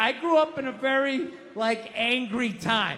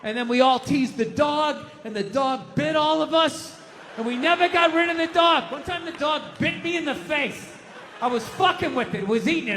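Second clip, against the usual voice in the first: ordinary delivery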